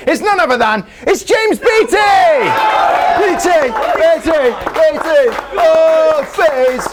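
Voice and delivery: high, loud, drawn-out shout; announcer shout. Real, not acted. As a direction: call out the guest's name. Announcing the special guest.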